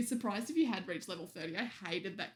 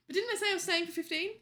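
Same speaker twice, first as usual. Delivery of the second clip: quick, higher, louder indignant question; surprised; 8 semitones higher, 4 dB louder, faster than usual. Real, not acted. Catching a viewer's wrong time estimate in quick surprise. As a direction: pounce on the mismatch.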